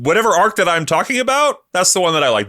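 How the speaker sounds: excited. Real, not acted. Laughing at own fickle taste.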